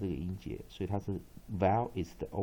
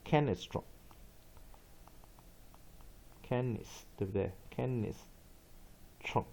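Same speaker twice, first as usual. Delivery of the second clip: slow Latin word demo; 5 dB quieter, much slower than usual. Shown as a demonstration. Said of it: "拉丁文".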